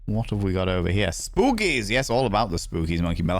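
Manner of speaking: normal talking voice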